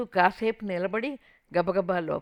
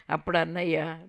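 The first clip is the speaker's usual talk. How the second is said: usual speaking voice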